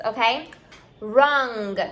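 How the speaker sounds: ordinary talk, nothing marked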